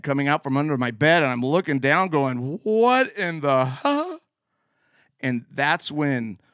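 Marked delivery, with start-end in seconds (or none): 2.6-4.2 slow, higher puzzled question; baffled. Retold or imitated. Boy peering under his bed at a red glow, baffled. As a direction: trail off in confused disbelief.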